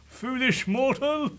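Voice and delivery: slow, high evil villain taunt; dark lord. Acted. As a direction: cackle like a cartoon dark lord.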